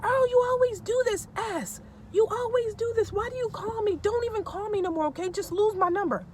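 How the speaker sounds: hammed-up high-pitched wailing rant; tearful screaming, imitated. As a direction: mimic an upset wife screaming through tears. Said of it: "she starts screaming to the top of her lungs, and then she starts crying".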